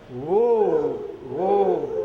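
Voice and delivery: loud drawn whoa calls; train whistle call. Acted. Train sound at night.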